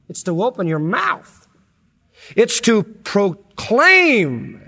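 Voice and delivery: loud, high, impassioned preaching; soaring revival preacher cry; preaching at full force. Acted. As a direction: build it up like a pulpit climax.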